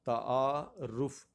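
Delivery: تعارف drawn out as "ta-aa ... ruf"; correct pronunciation. Shown as a demonstration. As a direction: say تعارف slowly in parts, ending "ruf". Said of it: "اعراب والا option".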